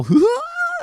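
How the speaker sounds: very high, loud squealed "whoa!"; imitated toddler squeal. Toddler turns to run from dad, imitated loud squeal. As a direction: mimic a thrilled kid fleeing.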